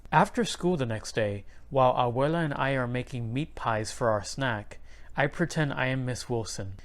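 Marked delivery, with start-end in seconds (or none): none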